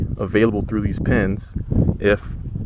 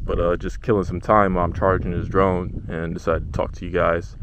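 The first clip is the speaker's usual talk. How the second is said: ordinary talk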